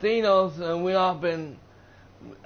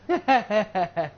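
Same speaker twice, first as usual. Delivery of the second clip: imitated gloating cackle; short, quick mock laugh; 3 semitones higher than usual. Villain laughs at her captor having no loved one, mocking laugh. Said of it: "she started laughing about it".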